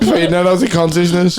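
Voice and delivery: normal talking voice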